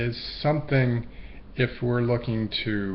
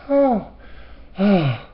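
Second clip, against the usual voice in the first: relieved oh; 10 semitones higher, 4 dB louder than usual. Retold or imitated. Imagined relief.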